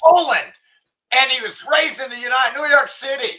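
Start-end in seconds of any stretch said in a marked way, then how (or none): 1.1-3.3 ranting shout. Real, not acted. Ranting over clip.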